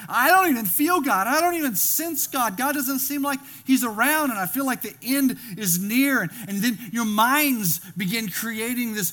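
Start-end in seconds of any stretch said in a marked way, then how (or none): 0.1-6.2 despairing. Pretend. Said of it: "you're looking around going".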